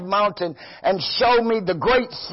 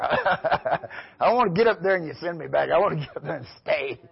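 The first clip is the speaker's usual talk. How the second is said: softer, chuckling through the words; a real laugh, light and amused; 4 semitones lower, 4 dB quieter, faster than usual. Real, not acted. Preacher laughing about not wanting to be sent back, amused. Laughing at his own eagerness.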